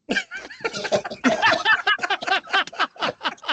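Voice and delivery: long high-pitched burst of laughter. Real, not acted. Remembering the teammate's limo, a high laughing fit.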